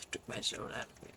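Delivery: soft unvoiced whisper; breathy whisper. Real, not acted. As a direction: breathe it out, drained. Whispered repeat.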